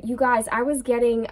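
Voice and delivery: usual speaking voice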